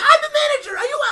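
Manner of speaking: high, loud mock shout; imitating the manager. Manager scolding the cashier, high mocking shout. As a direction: squawk it like a petty boss pulling rank. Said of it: "That's really how he sound".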